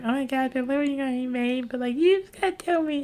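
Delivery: put-on high pleading girly whine; girlfriend impression. Mimicking girlfriend begging him to pick, high and whiny. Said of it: "She's like".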